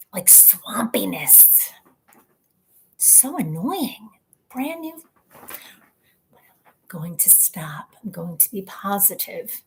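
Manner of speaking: whispered grumbling to herself. Real, not acted. Complaining about the grainy camera, whispered. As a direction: mutter it under your breath.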